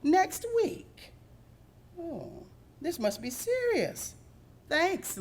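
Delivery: puppy shocked by the secret; alarmed surprise. Acted. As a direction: gasp at the news then take it seriously. Sassy alarmed by the news.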